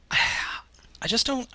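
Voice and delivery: breathy sighed aside; sighing whisper. Real, not acted. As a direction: let it out on a tired sigh. Sighing, weary of the game.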